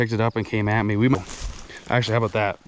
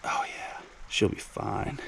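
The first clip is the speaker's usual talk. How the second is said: hushed whisper to the fish; 3 semitones lower, 11 dB quieter, slower than usual. Real, not acted. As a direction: breathe it out under your breath. Quietly releasing the fish.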